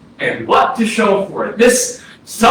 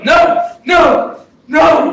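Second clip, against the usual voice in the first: sharp shouted no; 7 semitones higher, much slower than usual. Acted. Dead brother cutting off the writer, shouting no. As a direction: stop him cold with each no. Ghost cuts off his brother.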